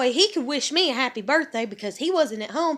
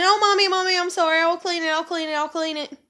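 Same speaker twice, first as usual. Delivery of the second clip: put-on higher, pleading kid voice; imitating begging child; 4 semitones higher than usual. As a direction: plead like a kid caught with a messy room. Voicing daughter promising to clean.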